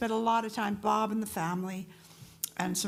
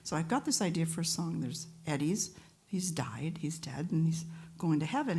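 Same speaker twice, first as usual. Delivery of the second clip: usual speaking voice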